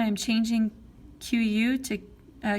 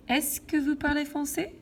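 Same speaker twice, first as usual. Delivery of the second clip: "Est-ce que vous parlez français" higher, rising at the end; question intonation; 5 semitones higher than usual. Shown as a demonstration. Teacher turns the statement into a question, rising intonation. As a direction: lift the voice through to a high "français". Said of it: "make this into a question".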